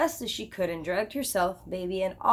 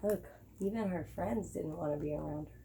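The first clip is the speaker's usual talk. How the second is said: quiet aside; 4 semitones lower, 10 dB quieter than usual. A narrator's reading. Narrator's sympathetic aside.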